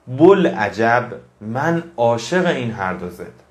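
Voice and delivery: slow, deliberate recitation of a Rumi verse; poem recitation. Acted. Reciting a Rumi couplet to his audience, slow and deliberate. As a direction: savor each word of the couplet slowly. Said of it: "مولانا چقدر قشنگ میگه".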